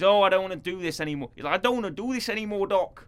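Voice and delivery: half-hearted Scouse impression of Dan; bad Scouse accent. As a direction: Liverpool accent done badly. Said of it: "I can't do a good John Bish-, John Bishop impression".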